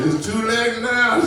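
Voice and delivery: high, loud mock inmate line; hammed-up jail taunt; inmate's taunt. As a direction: tease him like a cellmate. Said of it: "One dude said".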